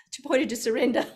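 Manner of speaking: ordinary talk, nothing marked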